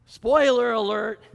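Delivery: laughing, loud and slow. Real, not acted. Laughing aside to congregation.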